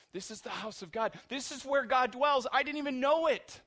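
builds to a loud, high cry; rising preaching fervor. Real, not acted. Preacher crying out to the congregation about Bethel, loud and rising. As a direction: lift the congregation with each line.

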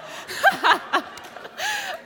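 high, loud shrieking laugh; helpless high laugh. Real, not acted. Preacher losing it over her blunder, helpless laugh. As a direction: dissolve into laughter.

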